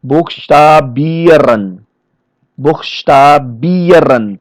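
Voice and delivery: "buchstabieren" very slowly, with a long BIE; stress on IEREN. Shown as a demonstration. Trainer showing where the stress goes, slow stress on IEREN. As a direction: stretch and lean on the ieren of "buchstabieren". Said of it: "the stress is going the ieren part".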